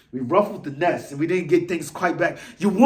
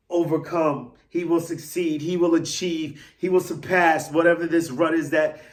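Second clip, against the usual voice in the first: ordinary delivery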